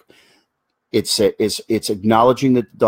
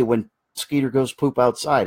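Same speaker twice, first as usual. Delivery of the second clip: ordinary talk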